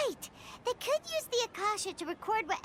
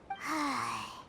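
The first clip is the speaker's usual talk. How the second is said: long low tired sigh; deflated sigh; 10 semitones lower than usual. Acted. Companion sighing after their theory falls apart, deflated. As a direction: let the air out in defeat.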